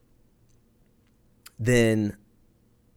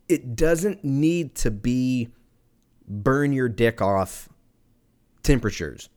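slow emphatic; slower than usual. Real, not acted. Ranting about tea temperature.

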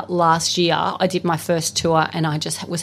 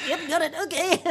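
higher, quick, embarrassed brush-off; mock flustered British stagehand; awkward stage manager; 10 semitones higher, 5 dB quieter than usual. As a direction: back away politely with a laugh. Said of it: "And he's like".

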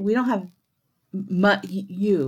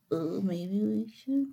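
slow, softer, mock-sheepish; sheepish group reply; 8 dB quieter, slower than usual. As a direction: imitate a chastened group conceding slowly and softly. Room's sheepish reply, retold.

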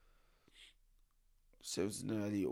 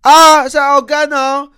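high, loud panicked cry; 17 dB louder, slower than usual. Retold or imitated. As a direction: yelp it in alarm. Retold panic at police.

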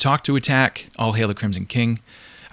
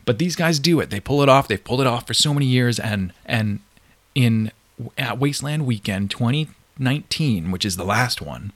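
usual speaking voice

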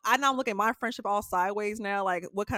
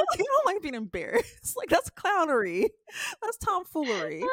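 higher, laugh-cracked voice; sobbing with laughter; 7 semitones higher than usual. Real, not acted. Woman repeating her point while laughing hard.

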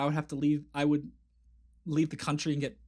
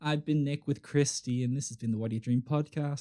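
ordinary talk

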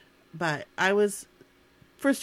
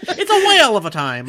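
put-on louder old-timey call through laughter; laughing barker; 4 semitones higher, 10 dB louder than usual. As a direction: giggle through the barker line. Continuing old-timey barker bit.

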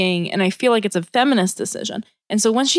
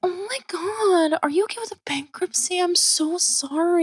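gossipy acquaintance cooing fake pity over bankruptcy; fake sweet pity voice; 8 semitones higher, 5 dB quieter than usual. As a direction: coo it like fake concern. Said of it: "in this voice too".

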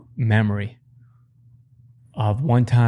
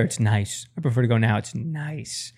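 softer, dreamy and contented; soft, blissful murmur; contented dad; 5 dB quieter than usual. Retold or imitated. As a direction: savor it quietly, like a secret pleasure. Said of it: "He's like".

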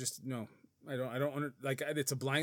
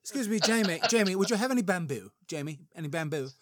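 put-on higher-pitched joke British accent, laughing a little; attempted British accent; 5 semitones higher, 5 dB louder than usual. Koala prince asking Jamie for bamboo, hammed British accent. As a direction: put on your best mock British voice. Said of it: "that's my best British accent".

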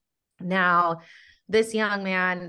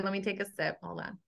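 while sipping a drink; 7 dB quieter, faster than usual. Real, not acted. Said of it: "A S M R here".

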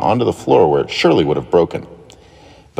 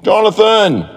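higher, louder exasperated call; Daniel yelling for his brother, higher and louder; 13 semitones higher, 3 dB louder, slower than usual. A narrator's reading. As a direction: shout his name, fed up. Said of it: "yelled Daniel".

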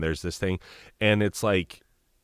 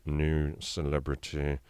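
very slow, deep, soft British drawl; Alan Rickman; 4 semitones lower, 6 dB quieter, slower than usual. Shown as a demonstration. Voice actor demonstrates a late British actor, slow and deep. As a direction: go deeper and slow it right down, softly British. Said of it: "great Alan Rickman".

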